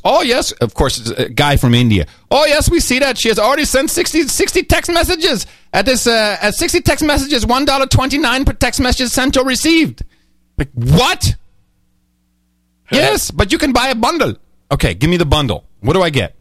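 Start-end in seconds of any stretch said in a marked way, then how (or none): 0.1-10.0 imitating an Indian call-centre agent, higher voice; mock Indian accent, pitched up. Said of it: "I'm sorry to do the accent". 12.9-14.4 imitating an Indian call-centre agent, higher voice; mock Indian accent, pitched up. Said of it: "I'm sorry to do the accent".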